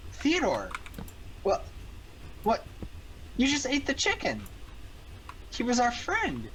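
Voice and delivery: high, horrified disbelief at his friend; shocked. Acted. Wolf aghast at Theodore for eating the chicken, shocked. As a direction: voice shooting up in shock. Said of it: "Wolf's just shook".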